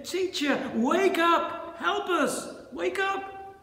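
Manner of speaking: high, urgent call for help; pleading cry. Acted. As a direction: beg him to wake up. Acted plea to wake Jesus.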